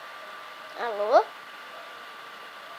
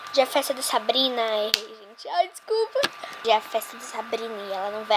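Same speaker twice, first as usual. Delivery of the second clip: normal talking voice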